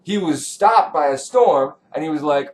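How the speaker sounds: normal talking voice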